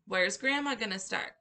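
ordinary delivery

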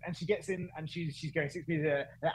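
usual speaking voice

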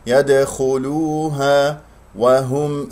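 slow chanted "يدخلوها" with rounded u and light l; light lam. Shown as a demonstration. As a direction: recite "يدخلوها" drawn out, l kept thin. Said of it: "ولا تفخم اللام".

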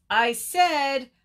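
high, drawn-out shout; storybook bird yelling to be heard. A narrator's reading. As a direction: holler it slowly, building to full volume. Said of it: "shouted Bird".

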